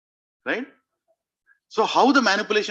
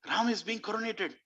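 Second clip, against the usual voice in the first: softly delighted, pitched up; very happy; 5 semitones higher, 7 dB quieter than usual. Acted. Kaikeyi reacts to Ram's coronation, very happy. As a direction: marvel happily at the news, lightly. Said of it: "she was very happy".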